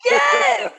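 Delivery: high excited yell. Real, not acted. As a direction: shout yes in delight. Excited agreement mid-story.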